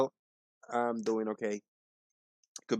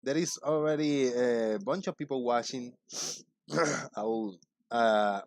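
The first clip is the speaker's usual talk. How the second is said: ordinary talk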